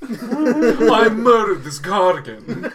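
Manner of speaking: slow, laughing bro boast; proud bro voice. Acted.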